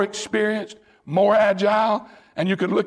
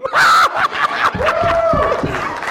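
high, loud burst of delighted laughter; joyous laugh; 4 dB louder than usual. Real, not acted. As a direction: laugh out in pulpit joy. Preacher's climax, joyful outburst.

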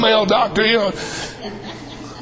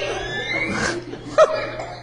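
laugh; 5 semitones higher, 6 dB quieter than usual. Real, not acted. Laughs at own joke.

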